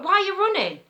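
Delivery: high, loud panicky question, acted out; imitated panic. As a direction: pitch it right up like an alarmed caller. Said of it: "So he were getting panicky".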